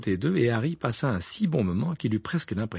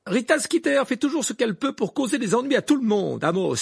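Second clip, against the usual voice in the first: much higher, louder rebuke; angry; 13 semitones higher, 6 dB louder than usual. A narrator's reading. Mrs Weasley rebuking Amos Diggory, angry. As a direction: snap back at him. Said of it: "dit Madame Wesley avec colère".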